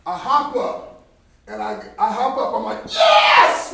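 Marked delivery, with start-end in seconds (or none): 2.9-3.7 high, very loud cheer; explosive high-pitched celebration; triumphant shout. Retold or imitated. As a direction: yell it out in triumph. Reenacts his cheer after catch.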